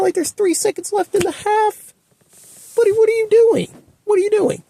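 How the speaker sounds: high, incredulous cry; exasperated. Real, not acted. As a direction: plead at the coach in disbelief. Fan exasperated at coach's call.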